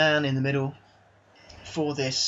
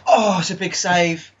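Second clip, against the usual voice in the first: louder, slow gasp of a near miss; excited; 4 semitones higher, 7 dB louder than usual. Real, not acted. Manager watching his side's shot saved, excited and loud.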